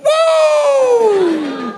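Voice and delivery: high, loud celebratory whoop; wild party whoop; triumphant whoop. Pretend. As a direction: shout for joy as the timer ends. Celebrating timer ending.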